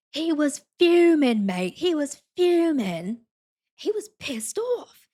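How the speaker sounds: high, slow mock British exclamation. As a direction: play up a British voice for laughs. Imitating British man's anger.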